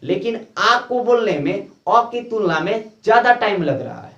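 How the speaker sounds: usual speaking voice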